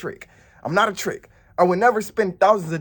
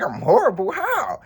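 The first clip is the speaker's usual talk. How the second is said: shocked disbelief; 6 semitones higher than usual. Retold or imitated. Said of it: "I was like".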